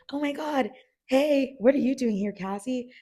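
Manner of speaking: high, loud fake surprise; surprised greeting. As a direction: greet her with big surprise. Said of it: "he's like".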